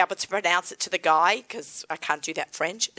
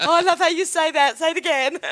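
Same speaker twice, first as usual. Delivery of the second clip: put-on higher, laughing gush over an accent; mock admirer; 8 semitones higher, 3 dB louder than usual. Host mimics people gushing at her Aussie accent, laughing. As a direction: tease like a fan of the accent. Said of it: "people would do to me".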